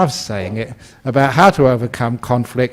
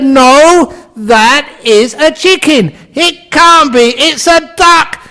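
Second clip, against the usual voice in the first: very high, loud bickering; hammed-up shrieked quarrel; shouting match; 7 dB louder, slower than usual. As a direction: trade contradictions in an escalating shout. Acted couple shouting back and forth.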